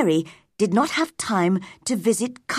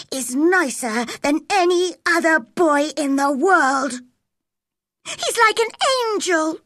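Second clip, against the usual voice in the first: heated defence; 9 semitones higher than usual. A narrator's reading. Mary defends Dickon to Colin, heated and high. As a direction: defend the friend passionately.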